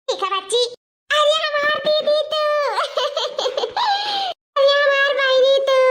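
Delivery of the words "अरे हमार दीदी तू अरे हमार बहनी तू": delighted welcome. Acted. Sister arrives, joyful welcome.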